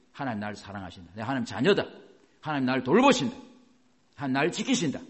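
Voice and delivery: firmly stated faith claims, each line landing hard; firm declaration. Shown as a demonstration. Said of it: "권세와 위치를 단호하게 말하셔야 돼요". (단호하게 선포하듯)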